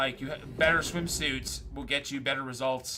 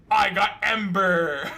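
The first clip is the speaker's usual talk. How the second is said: slow, louder, drawn out through a laugh; smug ad guy; 3 semitones higher, 6 dB louder, slower than usual. Retold or imitated. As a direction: stretch it out like the cocky guy in the ad. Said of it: "he's like".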